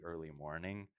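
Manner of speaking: usual speaking voice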